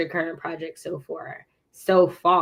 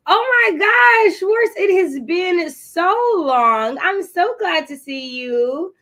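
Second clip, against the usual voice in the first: high, loud, slow gush of joy; excited greeting; 11 semitones higher, 5 dB louder, slower than usual. Real, not acted. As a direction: welcome back a long-lost friend. Greeting a long-missed viewer.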